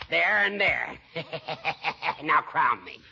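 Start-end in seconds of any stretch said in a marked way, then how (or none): 1.0-2.2 gloating laugh. Acted. Gloating over winning move.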